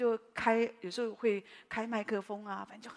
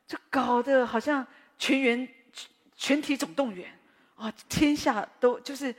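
mock frantic commotion; 4 semitones higher, 8 dB louder than usual. Mocking sisters' chaotic bustle.

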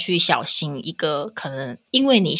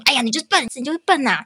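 high scolding parent voice; 7 semitones higher, faster than usual. Retold or imitated. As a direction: scold the child, pitched up. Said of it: "从小就骂说".

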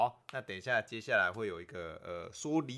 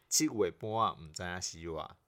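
saying the slang in Taiwanese Hokkien; 3 semitones lower than usual. Shown as a demonstration. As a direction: Taiwanese Hokkien proverb, clearly recited. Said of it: "好，再一次".

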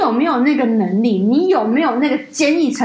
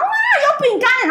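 high, squealing "有饼干" as if thrilled; mock delight; much slower than usual. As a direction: mimic greedy glee at the snacks. Mocking greedy colleagues again.

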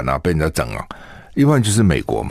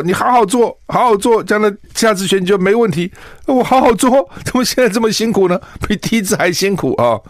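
much higher, whiny mock lament; frustrated complaint; 12 semitones higher than usual. The candidate complaining about a harder second race, whiny and much higher. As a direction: pout it out like a worn-out candidate.

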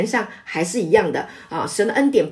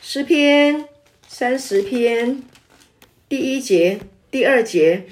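slow announcement; 4 semitones higher, slower than usual. Real, not acted. Announcing scripture slowly.

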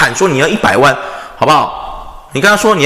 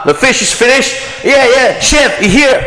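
mock kitchen chef yelling, pitch climbing higher; Gordon Ramsay impression; 8 semitones higher, slower than usual. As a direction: bark orders like an angry celebrity chef. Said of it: "你看戈登、拉姆齐他们不都这样子吗".

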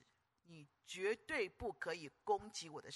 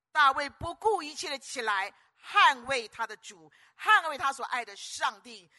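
loud, high defiant cry; thundering pulpit defiance; full-force preaching; 5 semitones higher, 11 dB louder than usual. Acted. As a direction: hurl the challenge at the enemy. Preacher defying attackers of God.

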